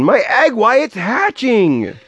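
high, amazed exclamation; thrilled storybook cry; Horton shouting. A narrator's reading. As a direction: stretch it out high with excitement. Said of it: "shouted Horton".

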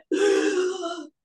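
high sharp gasp, like a sudden sob; imitated sobbing gasp. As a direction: mimic a man catching a sob. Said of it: "like, sobbed".

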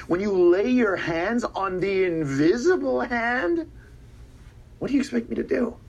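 slow, stretched menace rising at the end; theatrical slow build; dramatic drawn out. Real, not acted. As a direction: drag out every word for drama. Dramatic build about the invisible hand.

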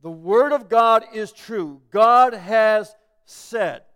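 loud, very slow proclamation; emphatic proclamation. Acted. Preacher declaring God's word true, loud and slow. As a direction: proclaim it word by word, at full weight.

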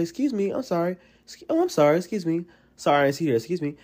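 high, quick stream of sorries; mock Southern courtesy, high and fluttery; polite apologizing. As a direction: act out a flustered, overly polite bump. Acting out polite bumping apologies.

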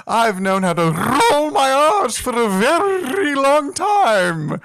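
high, slow hammy flourish; theatrical voice. Pretend. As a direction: roll the R's with grand drama. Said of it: "learning to roll your R's".

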